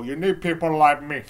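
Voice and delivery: imitated movie gangster accent; badly faked defiant gangster; Scarface. Pointing at friends while leaving, mock Scarface. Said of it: "can't do a good Scarface impression".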